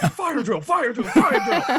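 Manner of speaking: sing-song mock alarm call; calling out fire drill. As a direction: chant it like a gleeful drill instructor.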